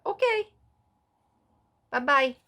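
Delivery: high, cute "bye-bye" in Mara's voice; Mara's sweet goodbye. Retold or imitated. Mara replying to the recording text, in a high, cute voice. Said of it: "Mara's the only one that said".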